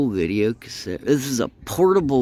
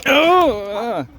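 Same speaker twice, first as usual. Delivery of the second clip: surprised; 13 semitones higher, 6 dB louder than usual. Real, not acted. Dog jumps on him.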